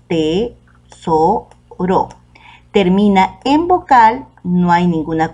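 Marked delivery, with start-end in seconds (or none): 0.1-1.5 "tesoro" said slowly as te-so-ro, each syllable split apart; "tesoro" spelled out slowly by syllable for a class. Shown as a demonstration. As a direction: drag "tesoro" out to count its three syllables. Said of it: "Tesoro está formado por tres sílabas".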